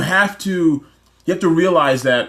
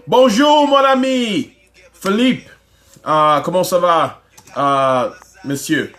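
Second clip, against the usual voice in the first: playful put-on French accent, pitched up on the greeting; mock French greeting; 3 semitones higher, 3 dB louder, much slower than usual. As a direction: greet him like a hammed-up Frenchman. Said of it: "the O V O man, the businessman".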